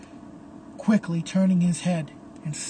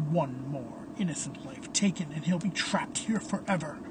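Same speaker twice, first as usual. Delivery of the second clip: talking to oneself; 2 semitones lower, 8 dB quieter than usual. A narrator's reading. Phantom paces alone in the bell tower, murmuring to himself. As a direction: think aloud under your breath. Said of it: "hears the Phantom speaking".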